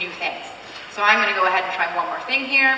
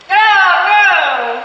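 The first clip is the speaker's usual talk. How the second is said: mock dismay; 9 semitones higher, 7 dB louder than usual. Real, not acted. Voice assistant misheard command again.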